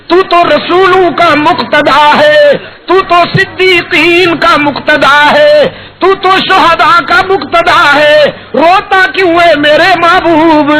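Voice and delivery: high tender calling voice; voice of God. Acted. God asking the weeping Prophet why he cries, tender. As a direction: call out lovingly and high. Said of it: "رب العالمین دیکھیں گے ... فرمائیں گے".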